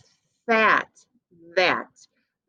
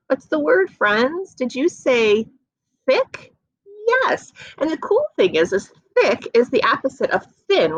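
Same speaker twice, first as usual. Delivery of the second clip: high, bright praise for the class; cheerful teacher; 8 semitones higher than usual. Real, not acted. Teacher cheering young learners, much higher. Praising children after the word.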